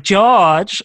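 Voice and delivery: called out name. Retold or imitated. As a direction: shout "George" up at the end. Repeating the crowd's chant in a bit.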